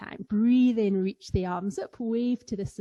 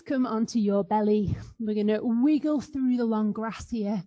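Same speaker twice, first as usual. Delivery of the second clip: usual speaking voice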